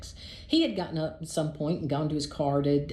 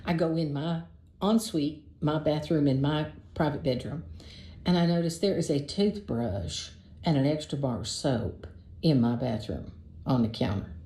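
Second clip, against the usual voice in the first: plain reading voice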